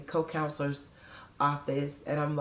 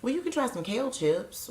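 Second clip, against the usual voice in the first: matter-of-fact; 8 semitones higher than usual. Retold or imitated. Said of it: "nicely, but very matter-of-factly said".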